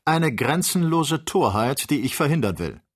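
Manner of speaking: hoch, laut und langsam, voller Empörung; empörter Freund. A narrator's reading. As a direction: jedes Wort der Torheit anklagen. Aufgebrachter Freund stellt Ferguson zur Rede. (höher, lauter und langsam empört)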